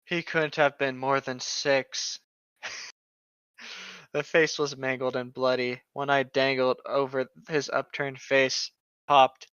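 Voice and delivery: quiet, hushed reading of a gruesome creepypasta; ominous story reading. Acted. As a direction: read it low and creepy, like a horror tale. Reads the creepypasta aloud.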